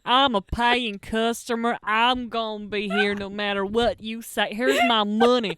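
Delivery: slow, insistent American customer; American accent. Retold or imitated. [name] as a stubborn American customer, slow American accent. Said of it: "I don't know why I'm American all of a sudden".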